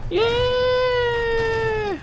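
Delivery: high long yell of triumph; wild cheer. Real, not acted. Player cheering wildly after the rebellious slogan. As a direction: let out a victory whoop.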